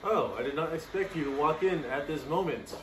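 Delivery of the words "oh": normal talking voice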